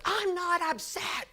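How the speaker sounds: high, drawn-out mock yell; indignant denial. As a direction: imitate a grouch insisting he's fine. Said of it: "he'd be yelling at himself".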